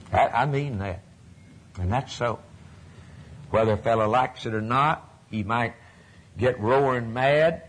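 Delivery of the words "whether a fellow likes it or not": normal talking voice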